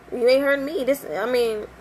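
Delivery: normal talking voice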